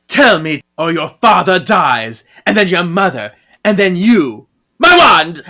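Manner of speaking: mock villain menace, climbing in pitch and volume; acted threat. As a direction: threaten loudly, building to a shout. Villain threatens family in retold scene.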